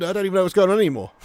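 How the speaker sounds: ordinary talk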